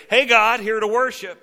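casual mock greeting. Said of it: "as if to say".

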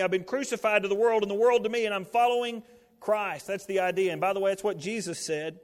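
high, loud, driving sermon voice; fervent preaching. Acted. Preacher urging the congregation to choose the cross, full force.

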